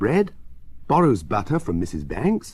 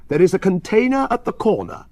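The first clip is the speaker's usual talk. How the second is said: raised, louder keeper's call; crying out; 7 semitones higher, 6 dB louder, faster than usual. A narrator's reading. Park keeper pointing out the container, crying out. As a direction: shout directions to the children. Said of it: "he cries".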